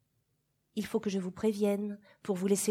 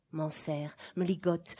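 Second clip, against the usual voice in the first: ordinary delivery, nothing marked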